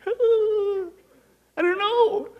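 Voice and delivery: squeaky high overwhelmed wail; high, hammed-up helpless cry; mock panicked shopper. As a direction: play it as a frazzled shopper near tears.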